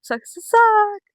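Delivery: high, slow, loud taunt; sing-song mock taunt, high and slow. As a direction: drag it out high like a gloating sibling. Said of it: "my sister in the background just goes".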